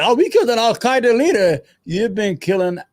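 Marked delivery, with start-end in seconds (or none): 0.0-1.6 higher, louder sneering mock of "killed"; mocking quote. Streamer mocks the US military claim, higher and louder sneer. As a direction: toss the official claim back scornfully. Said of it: "They lied".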